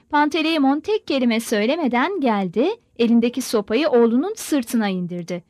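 ordinary delivery, nothing marked

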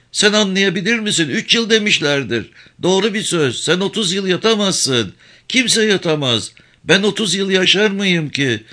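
high, pleading village woman voice; worried old mother. Acted. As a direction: press her son with anxious advice.

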